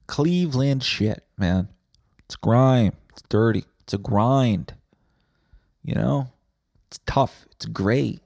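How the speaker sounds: ordinary talk, nothing marked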